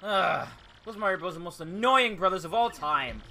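loud, fast grumbling villain; lightly hammed-up villain annoyance; annoyed villain king. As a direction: grouse about the rival brothers. Villain king reacts to rival's win.

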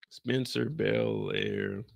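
very low, very slow movie-trailer boom; mock announcer voice. Reading the character's name, deep slow mock announcer.

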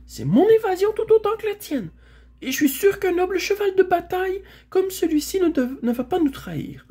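high, loud, indignant protest; defiant mare. Acted. Mare claiming her share of the escape, indignant. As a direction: insist passionately in a high voice. Mare defies Aravis in quarrel.

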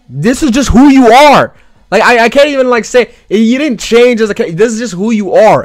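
hyped fan shouting; excited awe. Real, not acted. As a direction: rave at him in disbelief. Fan addressing Grimmjow.

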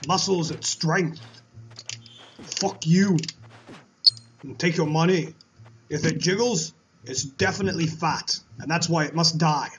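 a lightly put-on Austrian bodybuilder accent, boastful and crude; Arnold Schwarzenegger impression. Gamer voicing Arnie the Barbarian through the dungeon, mild Austrian strongman accent. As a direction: play a dim muscle-bound barbarian with a hint of an Austrian accent. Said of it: "we're going to be playing as Arnie the Barbarian".

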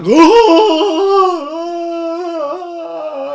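high, dramatic mock gasp; a big theatrical gasp; high drawn-out gasp like a toddler; imitated toddler gasp. Kid throws himself back dramatically.

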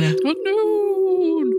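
high, drawn-out eerie echo; spooky high echo. Acted. Echoing the spooky intro word.

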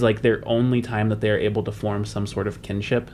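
ordinary talk

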